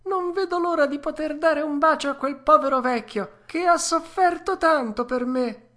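bubbling over with joy; joyful puppet. A narrator's reading. Pinocchio eagerly asking the fairy to go, overjoyed. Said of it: "saltando dall'allegrezza".

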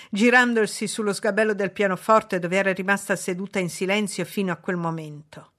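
ordinary delivery

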